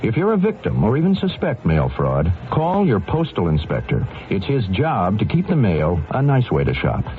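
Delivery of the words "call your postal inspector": normal talking voice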